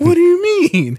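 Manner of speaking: confused. Acted. Prank call acted.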